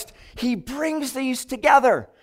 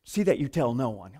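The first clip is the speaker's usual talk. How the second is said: hushed; 10 semitones lower, 10 dB quieter than usual. Retold or imitated. Jesus telling a healed man, quietly. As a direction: lower and softer, a quiet instruction. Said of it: "He's like".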